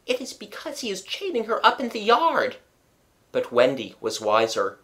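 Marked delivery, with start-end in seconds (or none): 0.1-2.6 put-on high child's whimper. A narrator's reading. John hears Nana barking, whimpering. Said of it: "John whimpered".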